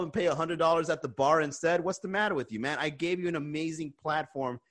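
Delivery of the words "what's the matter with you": ordinary talk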